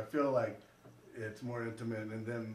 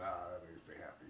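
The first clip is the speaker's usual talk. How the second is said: low, muttered imitation of gloating; much softer, mock-smug aside; spiteful satisfaction; 7 semitones lower, 13 dB quieter than usual. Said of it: "which is usually a spiteful s-, kind of thing".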